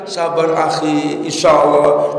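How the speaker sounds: low, slow, patient comfort; gentle consoling. Retold or imitated. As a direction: console a grieving friend, taking your time. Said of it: "Sopan santunnya, etikanya bilang gitu".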